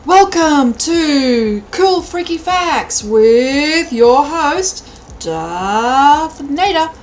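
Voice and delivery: slow, loud, drawn-out game show host voice. Pretend. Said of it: "Sounds like a game show".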